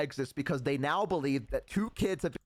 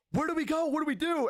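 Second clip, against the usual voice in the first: put-on higher, anxious kid voice asking what to do; worried friend; 12 semitones higher, faster than usual. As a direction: play the panicked friend, pitched up. Scared friend voiced in story.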